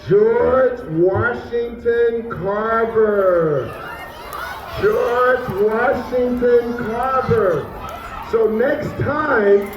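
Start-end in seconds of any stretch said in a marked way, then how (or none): 0.1-7.6 very drawn-out, ringing name; stately, stretched-out proclamation; grand slow reveal. Real, not acted. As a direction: announce the name slowly, stretching each part. Teacher announcing inventor's name.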